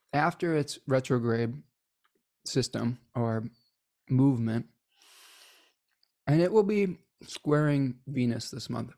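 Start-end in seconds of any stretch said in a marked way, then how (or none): 2.5-8.9 talking while munching a snack; chewing while talking. Real, not acted. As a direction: mouth half full. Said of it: "Sorry, I'm munching on a snack".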